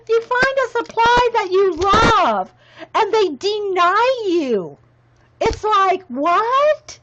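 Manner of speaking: slow, high, loud comic exasperation; mock indignation. Shopper denied a supply she loves, mock indignant. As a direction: draw out the complaint in hammed-up disbelief. Mock rant about discontinued pens.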